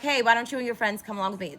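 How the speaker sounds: normal talking voice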